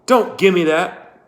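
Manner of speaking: loud, high, slow shout. Acted. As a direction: yell it down at her. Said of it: "shouted Trout".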